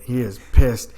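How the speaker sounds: ordinary talk, nothing marked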